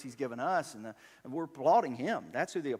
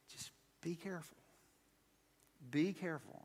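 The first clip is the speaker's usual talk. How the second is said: hushed, softly voiced warning; gentle caution; 2 semitones higher, 8 dB quieter than usual. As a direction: say it softly, as tender counsel. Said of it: "Jesus is saying to us".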